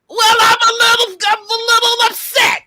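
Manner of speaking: high, loud upset shout. Acted. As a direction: yell it, at the top. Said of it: "It's still going up".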